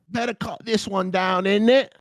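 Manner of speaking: mock British accent. Tree cutter deciding which palm to cut, mock British accent. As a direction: ham up a cockney tree cutter. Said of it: "What do you think he's saying before that picture?".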